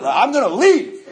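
storming out threat to the concierge, loud and slow. Retold or imitated. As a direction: raise your voice and hope it works. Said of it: "you just raise your voice and hope it works out".

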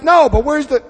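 high, loud mock protest; mock complaint. Complainer answers the question about steps, mock protest. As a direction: push back like a whining complainer.

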